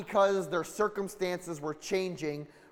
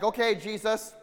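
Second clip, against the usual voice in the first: Peter demanding proof; 4 semitones higher, 6 dB louder than usual. Retold or imitated. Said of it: "he's like".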